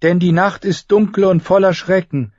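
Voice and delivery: lightly voiced congregation reply; response. Queen and faithful answering the prayer, lightly voiced. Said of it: "antworteten Selyse und ihre Getreuen".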